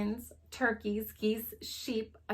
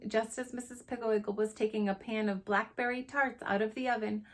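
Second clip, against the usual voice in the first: ordinary delivery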